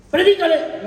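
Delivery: urging. Acted. Angel told to preach, urged again.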